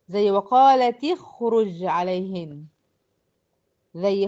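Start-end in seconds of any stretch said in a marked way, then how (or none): 0.3-2.6 slow "وقالت اخرج عليهن" as wa qalati-khruj; measured recitation example, "وقالت اخرج" linked by i; demonstrating the kasra link. Shown as a demonstration. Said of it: "تحريك الساكن الأول بالكسر".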